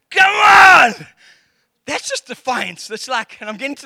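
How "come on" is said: high, loud cry of frustration; fed-up father yelling at his daughter; exasperated yell. Retold or imitated. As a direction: shout it, totally fed up. Dad exasperated.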